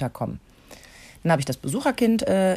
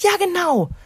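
put-on eager kid; 13 semitones higher, 4 dB louder than usual. Kind stimmt zu.

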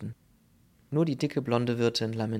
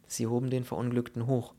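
plain reading voice

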